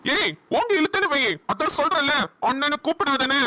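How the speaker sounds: furious outburst. Acted. Young man scolding his friend's sister for calling him brother, furious. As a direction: lose your temper and threaten her. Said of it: "செம்மையா கோவப்பட்டான்".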